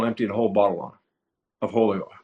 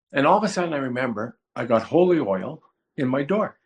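ordinary delivery

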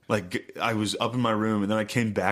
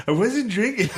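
high, loud protest with laughter; hammed-up guilty kid's shout; mock indignant denial; 10 semitones higher, 4 dB louder than usual. Retold lie to father.

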